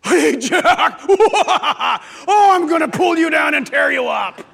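loud, cackling cartoon devil roar; mock demon voice. Shown as a demonstration. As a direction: bellow like an over-the-top monster. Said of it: "they don't speak to us like this".

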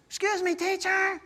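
put-on high, slow little boy voice; little kid voice. Five-year-old interrupting his teacher, high and slow. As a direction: play a polite small boy. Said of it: "this kid, Matthew, goes".

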